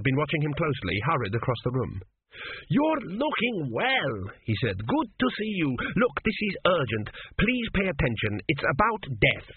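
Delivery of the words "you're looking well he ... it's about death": put-on an old king's ghost pleading, pitched up. A narrator's reading. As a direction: play the dead father, urgent and high.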